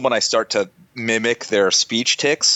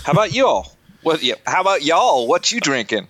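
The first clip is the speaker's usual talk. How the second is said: lightly drawled Appalachian "y'all"; Appalachian accent; 3 semitones higher than usual. Pretend. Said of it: "Appalachian Pete's coming back out".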